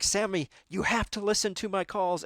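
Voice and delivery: a high, pressing plea; pleading co-driver. Retold or imitated. Co-driver pleading with Sammy to listen, urgent. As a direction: beg him to follow the notes. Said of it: "And he's like".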